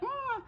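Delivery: short high shriek; a quick yelp of alarm. Retold or imitated. Townsfolk shriek as the hydrant sprays, high yelp. Car crash chaos in town.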